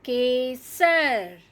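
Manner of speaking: Kesar drawn out, ke-sar, clear and even; slow model reading. Shown as a demonstration. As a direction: model Kesar slowly for children. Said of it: "धीरे धीरे सही उच्चारण".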